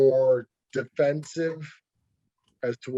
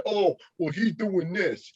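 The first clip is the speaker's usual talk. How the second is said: slow, whiny mock puller, pitched higher; mock whiny complainer; 5 semitones higher, slower than usual. Arm wrestler mocking a complaining puller, slow whiny voice. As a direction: drag it out like a sore loser griping. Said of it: "someone saying".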